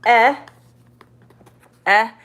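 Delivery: short E on "eh", as in bed; short vowel. Shown as a demonstration. Teacher showing what short E is, short vowel. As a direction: sound out "eh" twice, each on its own. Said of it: "and then E is".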